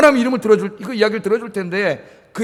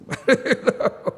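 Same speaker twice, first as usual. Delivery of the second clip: breathy laugh; 5 semitones higher than usual. Real, not acted. Laughs after the curse.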